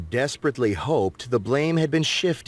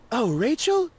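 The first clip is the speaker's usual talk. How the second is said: Gabby voice; 12 semitones higher than usual. A narrator's reading. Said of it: "Gabby said".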